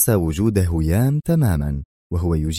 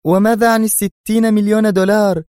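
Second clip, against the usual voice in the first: loud, very high, strained female voice; tense question; 13 semitones higher, 6 dB louder than usual. A narrator's reading. She challenges him about the money, tense, high and loud. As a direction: press the question with high-pitched tension. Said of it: "غمغمت في توتر".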